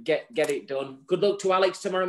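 usual speaking voice

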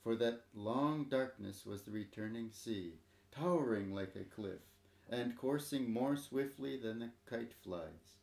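plain reading voice

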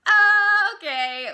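high, loud, drawn-out "oh"; uneasy okay. Retold or imitated. Reacting to the date she got, uneasy okay. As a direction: stretch out an awkward reaction.

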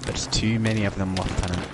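low, subdued officer voice; Captain McMillan. Acted. Captain warning his lieutenant of enemies, low impression. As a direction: keep it deep and restrained, warning a lieutenant. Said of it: "Captain McMillan voice".

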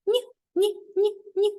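wag a finger at the wrong answer; playful no-no. Pretend. Playful rejection. (грайливе «ні, ні, ні»)

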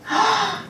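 short, high theatrical gasp; quick acted intake of shock. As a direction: gasp at terrible news. Medium hears how the ghost died.

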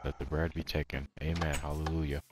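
hushed, low mock blessing; quiet put-on reverence; mock prayer. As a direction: intone it very softly and low. Mock prayer over the bread.

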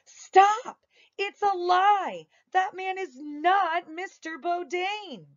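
high, loud shout to stop the clerk; a high shout across the office. Acted. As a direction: cry out to grab the clerk's attention. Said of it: "I shouted to get his attention".

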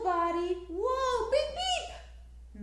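high, loud warning calls; playful shout. Shown as a demonstration. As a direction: call out like a car horn.

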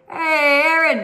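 calling out. Real, not acted. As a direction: call across the room. Calling out to someone.

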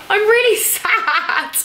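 high, drawn-out fake sob; mock sad wail. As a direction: wail it slowly in pretend sadness. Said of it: "Not really that sad".

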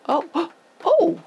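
sudden high gasp of alarm; surprised gasp. Real, not acted. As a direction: catch your breath at something unexpected. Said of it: "I just noticed where a hole punched through".

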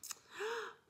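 sharp high gasp of alarm; dramatic gasp. Acted. Reading to kids, a big gasp as Lucy gets nervous.

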